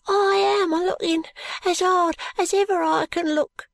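put-on high, thin ragged boy; street urchin voice. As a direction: speak like a timid street boy.

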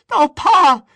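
put-on very high, loud wailing child; shaking with fright. A narrator's reading. Boy on his knees begs father, shrieking with fright. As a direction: wail it as a terrified boy. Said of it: "his voice shook with fright".

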